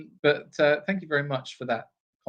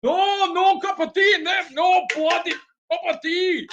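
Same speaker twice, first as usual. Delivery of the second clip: high, loud mock Scottish complaining, slow; hammed-up Scottish grumble about tea; Scottish mum; 8 dB louder than usual. Imitating the friend's mum ranting in Scottish about tea. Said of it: "It's like".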